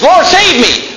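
high, pleading cry for rescue; desperate plea. Retold or imitated. Sinking sinner crying out to the Lord, pleading. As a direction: beg to be saved. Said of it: "It's just".

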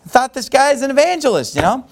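mad, angry. Retold or imitated. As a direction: vent your outrage at being snubbed. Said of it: "he's mad, he's angry".

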